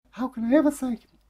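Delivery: put-on high, fast little girl voice; a child bubbling with thanks; gushing little girl. Girl gushes thanks to neighbour, high and quick.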